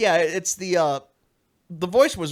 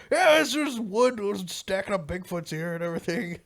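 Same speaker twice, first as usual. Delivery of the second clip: mock dumb confidence; 6 semitones higher than usual. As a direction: ham up a clueless declaration.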